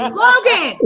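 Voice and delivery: loud sudden yell of a name. Real, not acted. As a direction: shout the name across the room. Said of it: "I'm trying to use my inside voice".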